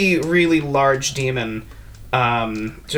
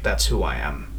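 a lower, quieter voice, dropped and settled; low quiet Sir M; 8 semitones lower, 4 dB quieter than usual. Acted. Sir M to the party, lower and quietly resigned.

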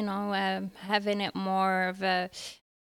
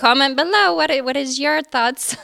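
upbeat outro; 4 semitones higher, 8 dB louder than usual. Acted. Addressing viewers.

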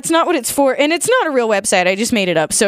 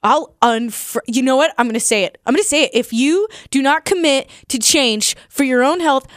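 ordinary talk, nothing marked

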